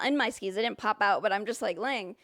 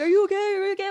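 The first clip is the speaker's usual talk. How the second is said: high, loud anxious asking; mock panicky concern; worried; 8 semitones higher, 5 dB louder, much faster than usual. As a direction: rush to check on someone who fell. People checking on her.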